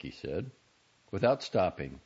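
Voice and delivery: plain reading voice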